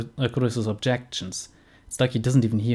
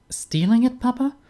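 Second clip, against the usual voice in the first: put-on much higher girl's voice, disbelieving; shocked young woman; 13 semitones higher than usual. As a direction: rise up high as the stunned daughter. Daughter shocked in game.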